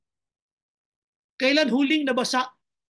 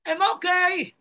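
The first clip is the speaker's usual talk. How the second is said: fake cheerful answer; 6 semitones higher, 5 dB louder, slower than usual. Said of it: "Hindi siya nag-pretend".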